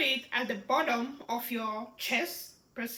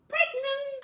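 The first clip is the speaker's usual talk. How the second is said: very high, held-out excited note; sing-song excited reveal; 13 semitones higher than usual. Shown as a demonstration. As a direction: squeal the news in a high pitch. Repeats emotional example sentence.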